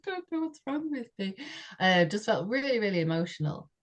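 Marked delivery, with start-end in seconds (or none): 0.1-1.4 tearful bewilderment. Retold or imitated. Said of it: "And I was just like".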